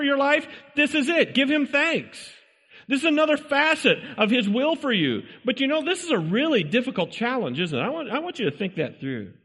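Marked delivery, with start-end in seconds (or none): none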